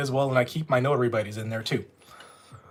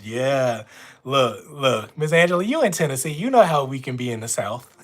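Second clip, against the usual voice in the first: usual speaking voice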